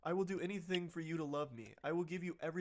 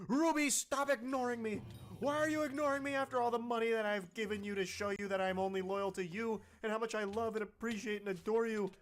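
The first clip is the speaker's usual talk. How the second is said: louder and higher, acting out a furious demand; mock angry rant; 5 semitones higher, 14 dB louder than usual. Reading Brandon's angry texts to Ruby, mock furious. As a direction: voice the texter losing his temper, raised and pitched up. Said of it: "So he's going full mask off".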